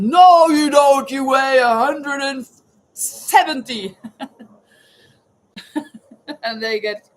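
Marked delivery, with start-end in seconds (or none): 0.0-4.0 mock husband shout. Husband loudly disputing his wife's claimed weight, mock shouting. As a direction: bellow it like an indignant husband.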